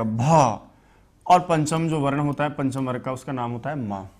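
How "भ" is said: भ with a breathy puff of air; aspirated bha. Shown as a demonstration. Teacher showing how the fourth letter भ is read. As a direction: push the air out on भ. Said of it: "चौथे अक्षर को कैसे पढ़ा जाएगा".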